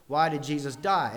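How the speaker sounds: "die" hit hard, then falling; stress on die. Shown as a demonstration. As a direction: punch the final "die". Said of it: "different emphasis on each of the words".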